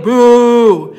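shouted boo at a villain; loud booing. Shown as a demonstration. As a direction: boo him loudly off the stage. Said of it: "there's loud booing".

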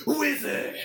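high, loud burst of "wizard"; teleportation cry. Acted. Wizard vanishing in lightning, high loud cry. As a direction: fling it out as a magic effect. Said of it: "and you just hear".

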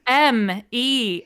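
slow letter by letter spelling. Shown as a demonstration. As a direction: spell each letter out slowly. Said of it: "I'm gonna spell it for the listeners".

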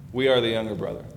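ordinary delivery

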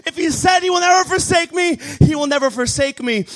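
high, driving preacher declaration; fervent pulpit proclamation. Real, not acted. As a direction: preach it at a high pitch, hammering each promise.

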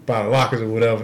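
ordinary talk, nothing marked

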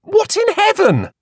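high, slow startled cry; shocked by being shoved aside; cried out. A narrator's reading. Queenie pushed aside by the woman, startled cry. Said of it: "Queenie cried".

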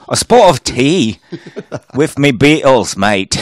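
hammy British with cockney touches; British accent. Acted. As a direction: put on a cheeky British accent. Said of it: "That's all I know in British".

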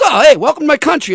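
high, proud "welcome to my country"; imitating a proud host greeting a guest. Retold [name]'s line.

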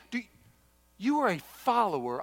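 ordinary talk, nothing marked

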